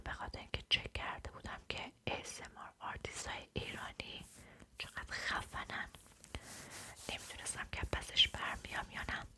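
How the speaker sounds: ordinary delivery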